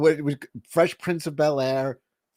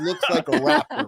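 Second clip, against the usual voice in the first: higher, laughing tease; 8 semitones higher than usual. Real, not acted. Host teasing his cohost about the rapper remark, laughing. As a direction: crack up mid-jab.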